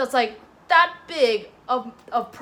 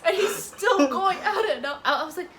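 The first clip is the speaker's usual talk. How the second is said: higher, laughing almost crying; 6 semitones higher than usual. Real, not acted. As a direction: wheeze through the laughter. Laughing through story.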